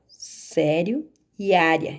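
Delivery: "Sério" with strong "SÉ" and "área" with strong "Á", said slowly; slow stress demo. Shown as a demonstration. Teacher to students, pointing out the strong syllable, slow. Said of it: "a sílaba tônica dessa palavra é a penúltima".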